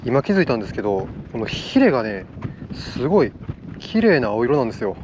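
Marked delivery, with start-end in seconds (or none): none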